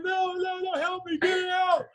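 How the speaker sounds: high, shouted panic; hammed-up panicked shout; panicked plea. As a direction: beg for help in a panic. Panicked after bee stings.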